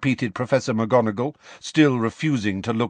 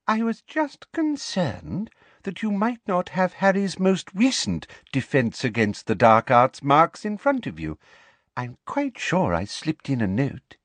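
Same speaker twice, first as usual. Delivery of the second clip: high-starting, sweetly insinuating voice; sugary, officious interruption; simpering professor; 8 semitones higher than usual. Acted. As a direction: needle politely with false concern.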